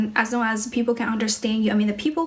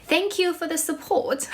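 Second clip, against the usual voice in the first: higher-pitched practice British accent; 6 semitones higher than usual. Shown as a demonstration. Streamer thanking a viewer, practicing a British accent. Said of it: "train my British accent".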